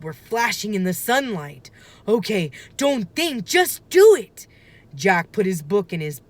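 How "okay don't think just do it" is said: building from a murmur to a loud high burst; psyching up. Acted. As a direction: force yourself to jump in. Jack psyching himself up.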